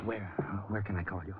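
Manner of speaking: nervous hushed aside at the door. Acted. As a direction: keep it down so the wife can't hear. Husband hiding talk from wife.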